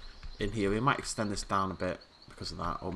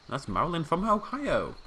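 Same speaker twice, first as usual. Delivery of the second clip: game show host; 8 semitones higher, 3 dB louder than usual. Pretend. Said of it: "Sounds like I'm presenting a game show".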